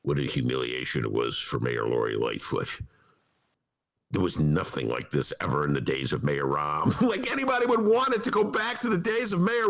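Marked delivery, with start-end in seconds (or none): 0.0-7.0 mock pundit tone. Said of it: "mainstream papers going".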